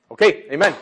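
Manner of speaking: ordinary talk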